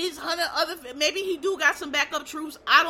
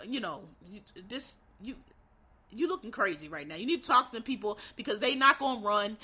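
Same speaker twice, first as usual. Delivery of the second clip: low mock China warning; 6 semitones lower than usual. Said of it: "now they like".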